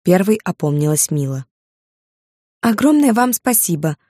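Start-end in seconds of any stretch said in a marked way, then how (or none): 2.6-4.0 high-pitched, effusive gratitude; gushing, voice lifted high; heartfelt thanks. A narrator's reading. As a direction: thank the waiter with great feeling. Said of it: "с чувством сказала она официанту".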